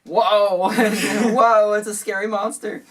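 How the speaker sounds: high, loud playful gasp; hammed-up amazement; mock awe. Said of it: "And she was like".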